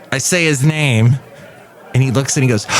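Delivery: usual speaking voice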